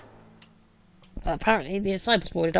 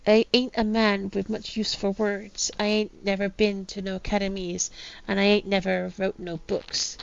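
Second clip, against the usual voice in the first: attempted pirate accent, reading aloud; 3 dB quieter than usual. Captain writes his last words, attempted pirate accent. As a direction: read it like an old pirate captain. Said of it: "my attempt at a pirate accent".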